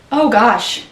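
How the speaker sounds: ordinary delivery, nothing marked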